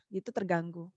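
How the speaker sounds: ordinary delivery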